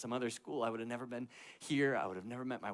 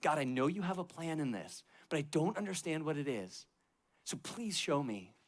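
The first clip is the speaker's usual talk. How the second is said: retold yelling prayer; 6 semitones higher than usual. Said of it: "I just started screaming at God".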